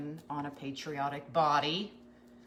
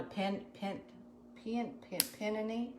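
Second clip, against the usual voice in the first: Tennessee peony; 3 semitones higher, 6 dB quieter than usual. Retold or imitated. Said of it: "In Tennessee, they call it".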